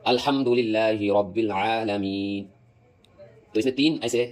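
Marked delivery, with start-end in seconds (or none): 0.0-2.5 measured tajweed demo of "الحمدللہ رب العالمین"; careful Quranic articulation. Shown as a demonstration. Teacher gives students an example verse, slow careful articulation. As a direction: slowly recite "الحمدللہ رب العالمین" with long vowels, distinct ح and ع. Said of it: "جیسے میں ایگزامپل دیتا ہوں".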